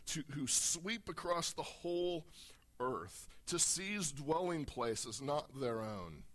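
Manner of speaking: solemn, declaiming reading; dramatic scripture reading. Acted. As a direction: read the prophecy with gathering dread.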